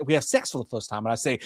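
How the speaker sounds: normal talking voice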